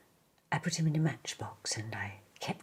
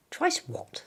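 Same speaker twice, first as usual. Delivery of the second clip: much higher, baffled little bear; puzzled Pooh; 14 semitones higher, 4 dB louder than usual. Acted. Pooh puzzled by the boy's sum, much higher. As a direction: squeak the question, lost.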